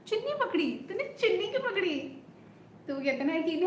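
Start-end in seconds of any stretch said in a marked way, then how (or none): 0.1-2.0 much higher, playful mock indignation; heroine's shy accusation. Heroine asking the soldier who held her scarf, high playful. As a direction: toss the question up high and teasing. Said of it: "कुछ तो ऐसे बोलती है ना".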